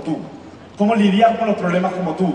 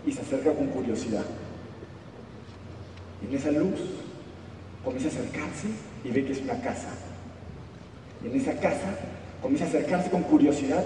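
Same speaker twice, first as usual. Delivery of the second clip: soft, low storyteller voice drawing listeners in; hushed story narration; 6 semitones lower, 9 dB quieter than usual. A narrator's reading. Telling the audience the wolf's story, hushed. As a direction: tell the fable gently and quietly. Quiet storytelling of the wolf fable.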